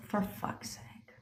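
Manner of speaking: hushed, voiceless swear under the breath; whispered curse. Retold or imitated. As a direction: curse to yourself so nobody hears. Muttered reaction to footage news.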